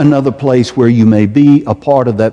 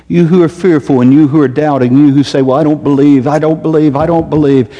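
raised, louder preaching voice; preacher pleading, higher and louder; fervent preaching; 5 semitones higher, 4 dB louder, faster than usual. Acted. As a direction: call out to the doubters from the pulpit. Preaches to doubters.

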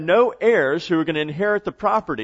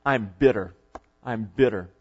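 low, subdued acted bitterness; bitter and angry; 6 semitones lower than usual. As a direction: let the anger sink into a low statement. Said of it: "She's angry".

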